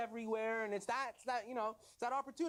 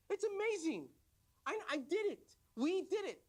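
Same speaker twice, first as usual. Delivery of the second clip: higher, jumping-for-joy excitement; excited; 8 semitones higher than usual. Acted. Husband calling his wife out to see the empty fence, thrilled.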